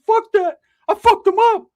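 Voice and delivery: high cartoonish whine of excuses; squeaky Ricky. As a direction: squeal it like a beat-up kid. Ricky voiced.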